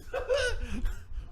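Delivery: a high burst of laughter, fading off. Real, not acted. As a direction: laugh high at your own joke. Laughs at his own joke.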